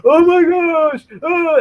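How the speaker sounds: high, slow shrieking mom; panicking mom. Retold or imitated. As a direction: mimic a frantic mother. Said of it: "the mom goes".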